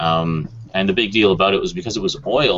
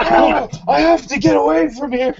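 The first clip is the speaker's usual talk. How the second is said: hammed-up old lady panic, much higher and louder; panicked old woman; 5 dB louder than usual. Old woman fleeing the eucalyptus, mock panicked squeal. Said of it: "She's like".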